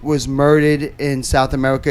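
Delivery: ordinary delivery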